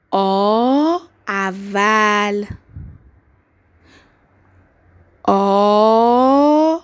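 آ اول drawn out very slowly and loud; stretched dictation sounds. Shown as a demonstration. Teacher dictating to young pupils, stretching آ very slowly. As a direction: stretch آ long for the kids to hear each sound. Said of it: "صدا کشی یادت نره".

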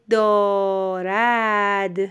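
دارد drawn out in two long syllables; slow dictation. Shown as a demonstration. Teacher dictates the last word to a pupil, very drawn-out. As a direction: stretch both vowels of دارد.

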